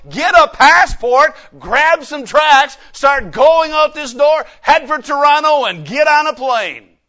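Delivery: loud, high, driving sermon cry; preaching at full force. Acted. Pastor preaching missions at full force.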